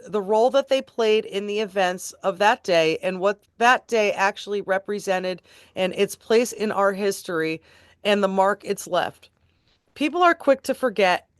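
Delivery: measured, emphatic reading of a judge's remarks; formal read-aloud. Acted. Reading a judge's sentencing remarks to listeners, formal and deliberate. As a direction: read it out like a court statement, clearly projected.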